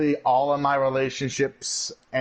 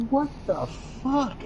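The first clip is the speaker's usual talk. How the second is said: drawn-out, much higher "what the fuck" kept quiet; slow, softer mock-stunned disbelief; 12 semitones higher, 3 dB quieter, slower than usual. Recalling his reaction to her paycheck spending, in stunned disbelief.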